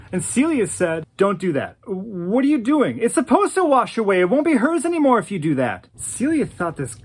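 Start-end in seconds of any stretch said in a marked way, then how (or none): 1.2-5.9 higher, louder, speeding up; scolding plea. Retold or imitated. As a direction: stop him from rebuilding the silhouette. Celia scolds Sam at creek.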